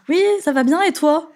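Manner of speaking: high-pitched, sing-out small-talk answer; chirpy reply. Retold or imitated. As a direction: play back a chirpy hallway reply, pitched well up. Acting out her reply to colleagues. (réponse enjouée, voix aiguë)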